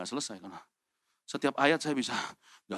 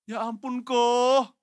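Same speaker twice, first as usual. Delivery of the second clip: high, loud drawn-out wail; indignant protest; 9 semitones higher, 9 dB louder, much slower than usual. Acted. Younger brother protesting to his accuser, loud drawn-out wail. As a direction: protest with stretched-out vowels. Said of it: "Kalau saya jadi Daud".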